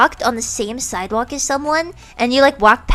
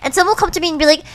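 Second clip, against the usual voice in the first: normal talking voice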